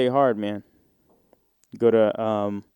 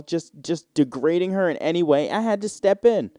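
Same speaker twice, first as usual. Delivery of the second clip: higher, earnest interviewee voice; lightly voiced bystander; 5 semitones higher than usual. Bystander explaining to the TV host, a hint of earnest interviewee. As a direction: answer the host like a sincere bystander.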